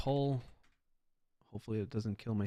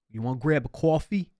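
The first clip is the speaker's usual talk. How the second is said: loud, high New York "You wanna grab a coffee?"; brash New York street voice; New York accent; 4 semitones higher, 7 dB louder than usual. Shown as a demonstration. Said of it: "In New York, that's how we talk".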